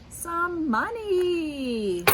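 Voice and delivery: drawn-out, high, excited reveal; big reveal. Acted. Teacher opening a plastic egg for the kids, slow high excited reveal. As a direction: stretch it out like a surprise for the kids.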